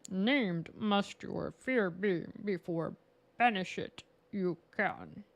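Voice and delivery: slow, put-on Yoda voice; a playful attempt at Yoda, dragged out slowly. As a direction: channel Yoda, weighing every word. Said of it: "Peter volunteers [name] for the Yoda accent".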